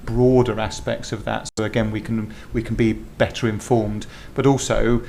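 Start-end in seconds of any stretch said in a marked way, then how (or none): none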